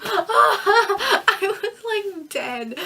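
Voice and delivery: high, loud burst of laughter; laughing. Real, not acted. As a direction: crack up while speaking. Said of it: "I'm laughing at my own memory".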